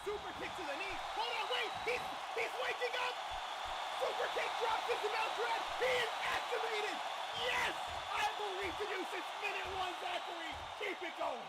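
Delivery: high, loud hyped comeback call; wrestling commentator. Real, not acted. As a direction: scream the comeback like a fan.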